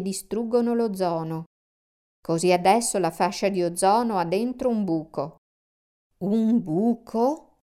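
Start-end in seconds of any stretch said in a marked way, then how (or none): 6.2-7.6 slow, drawn-out worried question. A narrator's reading. Tom reacts to the hole in the ozone, worried. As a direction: ask it slowly, with rising concern. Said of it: "chiese Tom preoccupato".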